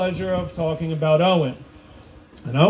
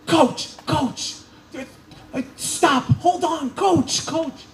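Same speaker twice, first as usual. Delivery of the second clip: pitched-up impression of an official calling after him; imitating her yelling; 11 semitones higher, slower than usual. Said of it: "So she's yelling".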